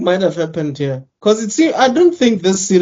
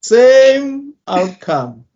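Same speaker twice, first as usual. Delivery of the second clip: "Same outcome" stretched very slow; drawn-out emphasis; 5 semitones higher, much slower than usual. Acted. Fan repeating "Same outcome" slowly for effect. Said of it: "It'll be the same outcome".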